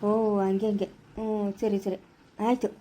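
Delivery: low-pitched, placating acknowledgement; meek agreement. Acted. Scolded man agrees to do as she says, meekly. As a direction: give in to the scolding, voice dropped low.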